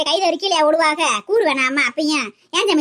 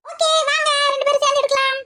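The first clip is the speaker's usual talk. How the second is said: high-pitched, bossy granny voice; bossy old woman; 10 semitones higher than usual. Acted. Grandmother insisting to the kids, high and bossy. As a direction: play the know-it-all elder woman.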